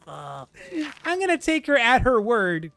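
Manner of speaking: high, slow, laughing mock of Grimshaw; laughing Grimshaw imitation. Repeating Grimshaw's line to the viewers, laughing and hammed up. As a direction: ham up the line while laughing at it.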